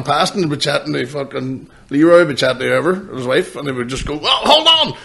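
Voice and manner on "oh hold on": high-pitched eager inmate on the phone; mock excited request; excited calling out. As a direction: call someone over to perform, voice pitched up. Acted inmate calling him over to phone.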